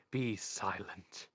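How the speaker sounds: very slow, cold order; cold command. Acted. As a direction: drag out the command, icy. Death silences Isabel.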